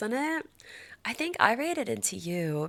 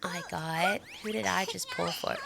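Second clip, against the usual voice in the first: normal talking voice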